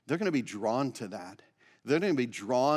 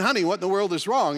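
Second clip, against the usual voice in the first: raised, worried cry to his wife; alarmed husband; 7 semitones higher, 6 dB louder than usual. Retold or imitated. Husband asks his crying wife what's wrong, alarmed and raised. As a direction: call out higher and louder in sudden concern. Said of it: "and he said".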